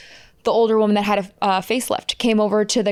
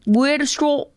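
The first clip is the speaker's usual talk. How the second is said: hammed-up, unconvincing Australian accent; bad Australian accent; 5 semitones higher than usual. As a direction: overdo a shaky attempt at Australian. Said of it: "That's supposed to be Australian".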